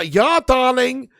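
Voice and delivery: high, very loud drawn-out shout; celebratory holler. Real, not acted. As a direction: belt it out slowly in mock triumph. Celebrates the manager news.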